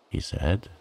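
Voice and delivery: plain reading voice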